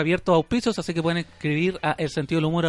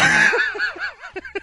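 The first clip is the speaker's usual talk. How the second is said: carcajada chillona y fuerte; carcajada aguda; 7 dB louder than usual. Real, not acted. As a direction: estalla en una risa aguda. Carcajada aguda tras el chiste. (risa aguda)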